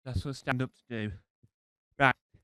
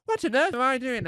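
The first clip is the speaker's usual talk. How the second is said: bewildered; 10 semitones higher, 7 dB louder than usual. Retold or imitated. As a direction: cry out in disbelief at yourself. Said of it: "I'm going".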